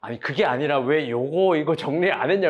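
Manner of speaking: lightly voiced grumbling husband; mock husband. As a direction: drag it out like a nagging husband. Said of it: "그러니까 아내가".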